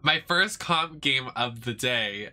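slow, careful retake of the line; deliberate, drawn-out self-correction. Real, not acted. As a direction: take it slowly so "day" lands cleanly. Corrects the slip, slowly.

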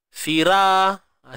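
ordinary talk

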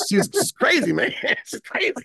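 high, laughing delight; real laughter while marveling at her. Real, not acted. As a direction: laugh it out, tickled by the memory. Laughing remembering [name].

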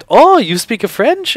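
high, loud, delighted mock French accent; imitated French speaker. French man finds reporter speaks French, high excited French accent. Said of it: "then he started speaking French".

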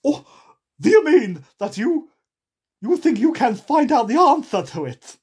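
loud, high eccentric character; madcap, high and forceful; Hatter. A narrator's reading. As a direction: challenge in a booming put-on voice. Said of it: "said the, uh, the Hatter".

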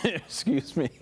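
laughing. Real, not acted. Anchor giggles mid-read.

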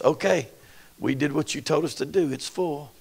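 servants' quiet report. Acted.

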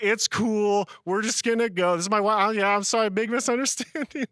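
smooth things over fast; nervous apologetic peacemaker; peacemaker mode. Retold or imitated. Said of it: "like, peacemaker mode".

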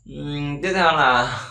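ordinary delivery

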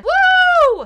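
high, loud whoop; cheer. Real, not acted. Cheering for Jen's win, a high loud woo. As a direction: let out a big victory whoop. Celebrating the winner.

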